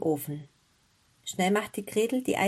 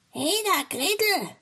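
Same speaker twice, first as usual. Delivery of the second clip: high, swooping witch's call; high-pitched summons from the wicked witch; 10 semitones higher than usual. A narrator's reading. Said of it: "rief sie".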